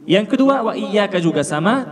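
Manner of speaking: ordinary talk, nothing marked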